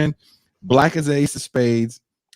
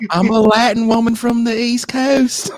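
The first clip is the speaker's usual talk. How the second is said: southern belle; 9 semitones higher than usual. Pretend. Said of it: "why do I talk like a Southern belle".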